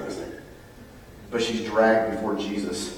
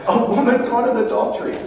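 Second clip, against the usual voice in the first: laughing; 13 semitones higher, 5 dB louder than usual. Real, not acted. Laughing at the idea.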